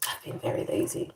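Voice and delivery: soft hushed lazy admission; hushed admission to viewers; hushed confession. Real, not acted. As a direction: confess it in a low hush.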